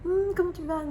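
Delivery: high-pitched, exaggeratedly obliging greeting; people pleaser. People pleaser greeting others while bottling it up, in a high put-on voice. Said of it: "je suis très people pleaser".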